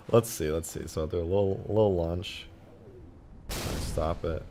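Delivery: ordinary talk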